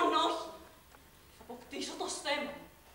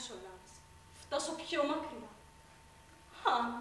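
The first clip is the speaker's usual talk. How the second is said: normal talking voice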